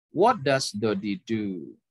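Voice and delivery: slow question, pitch sinks on the final do; falling intonation. Shown as a demonstration. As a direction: bring What does Dodi do down at the end. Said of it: "intonasinya turun".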